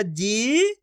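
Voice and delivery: जी held long with a rising tune. Shown as a demonstration. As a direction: stretch जी out, letting it rise. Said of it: "खींच के पढ़ा जाता है". (जी को खूब खींचकर)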